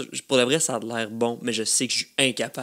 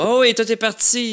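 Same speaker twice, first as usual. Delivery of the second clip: fake chit-chat; 9 semitones higher, 4 dB louder than usual. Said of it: "je fais une fausse discussion".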